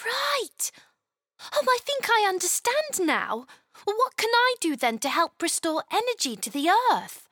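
put-on high, eager little girl; curious child asking how to help; young girl voice. Chloe asks eagerly.

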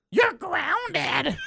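high, mocking mom voice; exaggerated imitation of a scolding mom; mom grounding him. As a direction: squeak out the punishment like an annoyed mother. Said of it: "Mom hangs up the phone".

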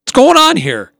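mock outrage at the prank; indignant alarm. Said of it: "I'm like".

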